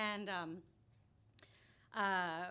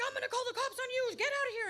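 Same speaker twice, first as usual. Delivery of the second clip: angry cab driver; 11 dB louder, faster than usual. Retold or imitated. Cab driver shouting her out.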